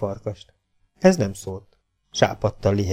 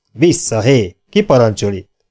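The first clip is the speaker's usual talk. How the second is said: louder, rough command to back off; harsh shout; 2 semitones higher, 8 dB louder than usual. A narrator's reading. Outlaw warning the bandits off, harsh shout. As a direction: bark them back harshly. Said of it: "kiáltotta nyersen".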